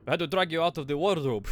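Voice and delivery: put-on terrible Russian accent. Sailor scolding the stowaway he found, terrible Russian accent. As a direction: do a comically bad Russian accent. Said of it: "all getting this fucking terrible Russian accent".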